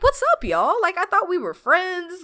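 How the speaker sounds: high-pitched, mock-hurt plea to friends; imitated indignant appeal. Ito to the friends who won't help, mock-indignant.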